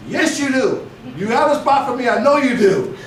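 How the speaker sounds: loud, firm, with a laugh at the end; loud insisting. Retold or imitated. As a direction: press the coach, loud and sure. Insisting coach has a spot.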